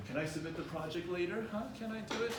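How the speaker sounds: mildly hesitant, rising question; uncertain asking. Shown as a demonstration. Student unsure, asks to submit the project late. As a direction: sound unsure, voice going up high. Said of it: "Sounding certain".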